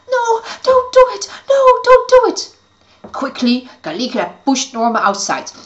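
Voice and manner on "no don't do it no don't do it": high, loud desperate scream; screaming fairies. Acted. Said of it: "all the fairies started screaming".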